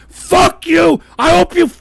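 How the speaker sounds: angry shout. Real, not acted. Host cursing the trolls, real furious shout. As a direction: spit it out in real rage.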